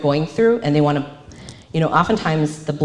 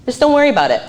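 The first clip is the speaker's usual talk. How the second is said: dismissive; 7 semitones higher, 5 dB louder, much faster than usual. Retold or imitated. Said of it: "that's what someone's gonna respond with".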